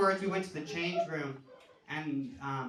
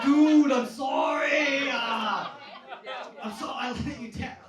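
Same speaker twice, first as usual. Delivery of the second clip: higher, much louder, drawn-out laughing cry of sorry; anguished apology; 8 semitones higher, 10 dB louder, slower than usual. Retold or imitated. Dad apologising to his shocked son, anguished comic shout. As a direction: wail the apology like you failed him. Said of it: "I'm like".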